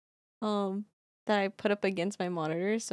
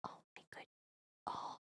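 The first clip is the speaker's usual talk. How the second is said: breathless whispered exclamation; whispering; 15 dB quieter, faster than usual. Real, not acted. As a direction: barely breathe it. Whispered reaction.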